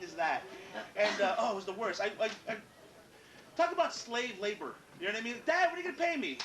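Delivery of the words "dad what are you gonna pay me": put-on high, pleading little-kid ask; whiny kid voice. Boy asking his dad for wages, high and pleading. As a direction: play the ten-year-old begging dad for money.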